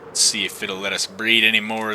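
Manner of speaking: ordinary talk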